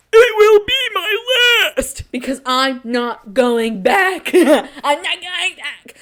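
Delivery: slow, high, hammed-up wail; dramatic vow. Real, not acted. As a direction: drag it out like a soap-opera oath.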